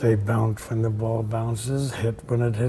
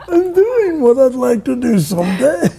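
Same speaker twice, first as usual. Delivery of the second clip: much higher and louder, stunned; shocked student; 13 semitones higher, 12 dB louder than usual. Retold or imitated. Student realizing he hit it well, stunned and loud. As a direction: blurt it out in disbelief.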